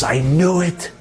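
high, strained voice imitating a man in tears; retold weeping, pitched high; tearful outcry. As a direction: act out breaking down as a suspicion proves true. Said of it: "Shlomi started crying. He goes".

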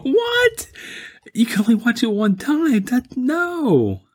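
real disbelief at the friend's claim; incredulous. Real, not acted. Objecting to a co-host's movie verdict, incredulous high protest. Friend says watch only once.

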